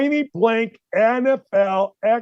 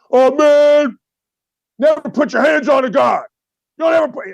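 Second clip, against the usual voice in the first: high, loud mock outrage; mocking offended ex-player; 7 semitones higher, 5 dB louder than usual. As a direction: ham up a touchy ex-player yelling.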